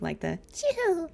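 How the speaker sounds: high, slow chewing sound; imitated chew noise. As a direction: mimic the little creature's chew. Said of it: "the little noise they make".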